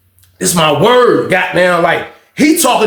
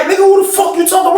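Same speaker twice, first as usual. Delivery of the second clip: high, indignant imitated confrontation; high-pitched retold quarrel; mock angry challenge; 11 semitones higher than usual. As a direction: act out squaring up, pitch way up. Acts out quarrel before fight.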